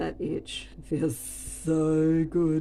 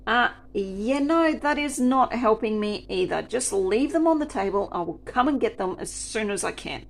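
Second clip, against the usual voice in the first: louder and higher, exasperated; retold irritated refusal; 3 semitones higher, 6 dB louder than usual. Server snapping back at the customer. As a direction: brush her off, fed up.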